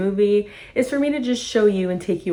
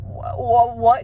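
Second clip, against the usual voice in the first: mock panicked stammer, slightly raised; beside himself; 4 semitones higher, slower than usual. Fan retells Brett still beside himself, stammering in mock panic. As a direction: stumble on the word like a terrified man. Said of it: "he's still beside himself".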